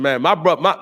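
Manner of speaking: ordinary delivery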